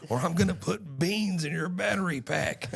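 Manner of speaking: high-pitched, exasperated mock threat; mock angry threat. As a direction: threaten the robot like a fed-up owner, voice way up. Retelling angry owner threatening robot.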